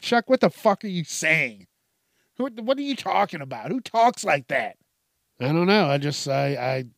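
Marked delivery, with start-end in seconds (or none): 0.0-4.8 imitated incredulous reaction, pitched up and louder at first; mock bafflement. Listeners questioning Chuck's dirty talk, mock bafflement. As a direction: play an appalled listener, half joking.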